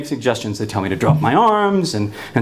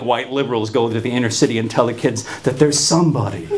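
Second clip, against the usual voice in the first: normal talking voice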